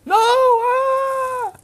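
very high, loud screamed no; horrified scream. Acted. Student faced with a cockroach, mock terrified scream. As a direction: scream it out in hammed-up panic.

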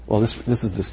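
ordinary delivery

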